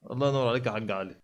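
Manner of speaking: normal talking voice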